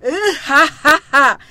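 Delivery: slow villain laugh; slow mocking laugh. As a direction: laugh him off slowly. Demon king mocks Wukong. (느리게 비웃는 웃음)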